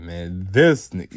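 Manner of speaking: loud, drawn-out retold complaint; mock exasperation. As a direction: drag it out loudly, as if fed up. Retold All Might reacting in exasperation.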